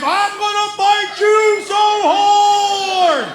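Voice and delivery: high, slow, drawn-out yell; furious battle cry. Retold or imitated. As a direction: imitate a kid charging out to fight. Son charges out like a bull.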